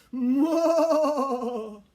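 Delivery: a big loud cackle; loud laugh. Acted. Ghost makes a face.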